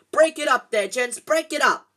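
high, very loud booming order; bellowing. A narrator's reading. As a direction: roar over the whole crowd. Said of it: "louder than all".

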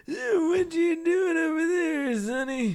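put-on high, slow old-timer; put-on elderly voice; old man voice. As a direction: play a doddering old man. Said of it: "I very much like my old man voice".

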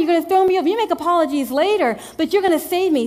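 normal talking voice

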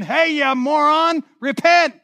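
mock shout. Preacher voicing a scornful buddy shouting at Job, high mock shout. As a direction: yell it down at him like a know-it-all buddy. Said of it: "one of your buddies shouting into your life".

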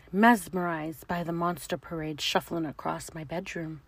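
usual speaking voice

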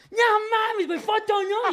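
excited fans. Retold or imitated. Fans beg for photo.

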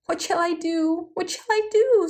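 high, rising wail of despair; acted sobbing. A narrator's reading. Shepherd stranded on the dragon's back, acted sobbing. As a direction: lament helplessly, climbing in pitch. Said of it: "sobbed Batya".

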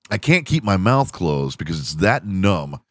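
ordinary talk, nothing marked

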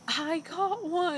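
mock triumphant yell, stretched out; retold triumph. Podcaster retelling the contestant announcing her catch, triumphant.

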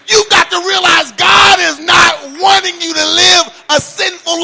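loud, high preaching build; imitating preacher. Pastor voicing a typical preacher's sermon, building to a shout. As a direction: rise to a full preaching shout. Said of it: "have you heard this preacher say".

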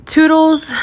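high, loud chirpy toodles; playful sign-off. Pretend. Signing off to podcast listeners, high and chirpy. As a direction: chirp it out like a cheery goodbye.

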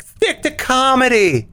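mocking quote of a critic; imitated reviewer complaint. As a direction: imitate a grumbling reviewer, drawn out. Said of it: "we got a review on the show. They're like".

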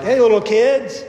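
put-on a light, teasing call of little kids; lightly voiced guy on the shore, teasing; guy on the shore hailing grown fishermen as kids; calling the disciples kids. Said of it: "John puts in here children".

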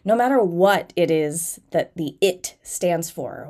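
ordinary talk

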